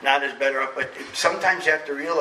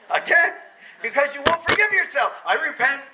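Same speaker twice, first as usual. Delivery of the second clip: laughing; 11 semitones higher than usual. Real, not acted. Laughing at repenting again.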